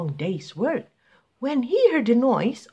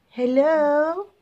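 higher, louder nervous hello into the empty village; nervous call; 9 semitones higher, 5 dB louder than usual. Acted. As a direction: call out timidly. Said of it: "he called out nervously".

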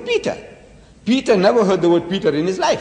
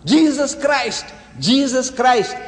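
raised, slow calling of the name, higher; crying out; 8 semitones higher, 4 dB louder, slower than usual. Retold or imitated. Believers calling to Jesus at his coming, a raised slow cry. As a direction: call out the name, pleading for him to turn. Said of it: "you cry out".

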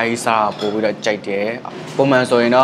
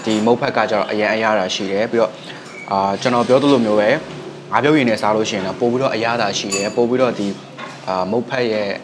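chewing between words; eating noodles while speaking; mouth full while talking. Real, not acted. Seen 1: Holds noodle bowl and spoon; mouth looks partly full, chewing.

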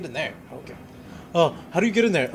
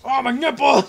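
high loud yelp of pain; 9 semitones higher, 7 dB louder than usual. Real, not acted. Startled gamer yelping at the screen, pained yelp. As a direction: yelp like you just got hit. Game scare, yelping at a monster.

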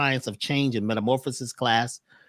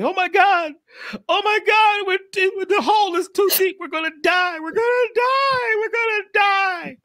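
very high, loud squeaky frog voice, panicking; a terrified little frog shrieking that they'll die; panicked frog; 5 dB louder than usual. Acted. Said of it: "one frog started saying".

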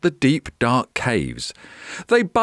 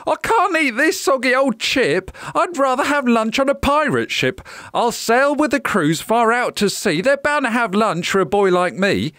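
put-on high-pitched child's voice, indignant; fussy little boy, complaining; 9 semitones higher than usual. A narrator's reading. Jack rejects his cold lunch, high boyish complaint. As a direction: play a small boy refusing his food.

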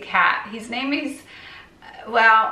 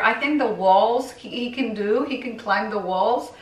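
normal talking voice

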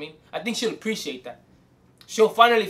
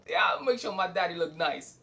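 ordinary talk

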